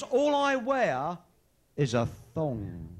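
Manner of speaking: slow, drawn-out tease, then a low drop; sly tease. Acted. Teasing reveal to the women.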